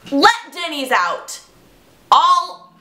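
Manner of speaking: usual speaking voice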